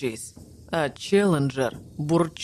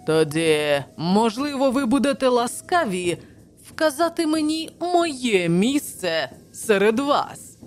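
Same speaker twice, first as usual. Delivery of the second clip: higher, exaggeratedly polite sarcasm; mocking courtesy; 6 semitones higher, 3 dB louder than usual. A narrator's reading. Challenger asks Summerlee for his place, with mocking courtesy. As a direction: lay the politeness on thick and high. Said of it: "відважив йому глузливий уклін".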